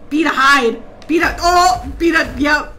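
loud, urgent bursts; panicked shout. Real, not acted. Player scrambling to hide, panicked shout. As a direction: yell as if caught hiding.

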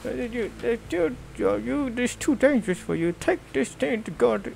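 put-on high, wobbly old man from a game. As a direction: play the cave hermit handing over a sword. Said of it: "With the old man in the cave".

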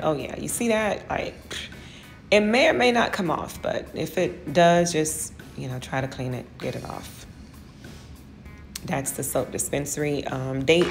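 ordinary talk, nothing marked

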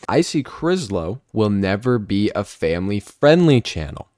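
loud, slow, declaiming delivery; emphatic announcer read. Acted. Host reading a fun fact to viewers, loud emphatic announcer. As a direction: read it out loudly with drawn-out emphasis.

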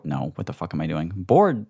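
ordinary talk, nothing marked

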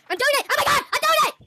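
high, loud thrilled shout; overjoyed it finally works; excited. Pretend. Finally moves in the game.

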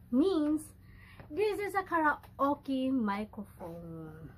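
high, slow, drawn-out reveal; excited announcement. Real, not acted. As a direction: announce it proudly, stretching each word. Announces what the gift is.

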